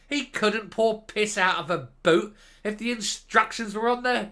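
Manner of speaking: loud, slow, high-pitched contempt; furious rant. Real, not acted. A commentator raging at a politician, furious loud rant.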